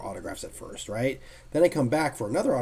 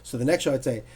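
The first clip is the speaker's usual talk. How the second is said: ordinary delivery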